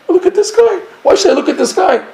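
incredulous, why on earth; busy cousin scoffing at the Rebbe; exasperated outburst. Retold or imitated.

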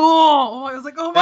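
high, loud excited yelp. Real, not acted. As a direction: blurt it out in sheer delight. Excited gamer reliving a moment.

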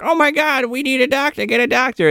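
high-pitched mock panic; mock freak-out. Host imitating Kathleen begging for a doctor at the dock, mock freak-out. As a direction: plead frantically, imitating a panicked woman. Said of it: "Kathleen's freaking out. She's like".

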